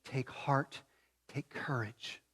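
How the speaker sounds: very soft, hushed and fading; tender near-whisper encouragement; still small voice. Acted. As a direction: let it fade to a hush. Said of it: "that still small voice that says".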